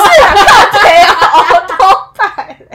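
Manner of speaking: slow laughing Taiwanese slang; taiwanese accent. Retold or imitated. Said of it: "哎，你这人很机车".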